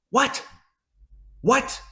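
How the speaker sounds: high, isolated yelps of bafflement; stunned disbelief. Real, not acted. Fan baffled by Louisville losing to Cal, stunned disbelief. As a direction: let each word pop out high after a pause.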